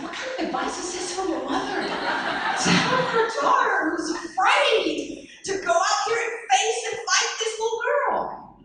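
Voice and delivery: can you believe this advice; telling the audience how absurd mom's advice was; incredulous. Retold or imitated. Incredulous reaction to mother.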